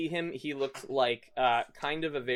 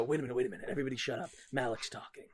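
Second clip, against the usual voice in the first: hushed urgent voice; 2 semitones lower, 9 dB quieter, much faster than usual. Acted. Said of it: "you hear this guy go".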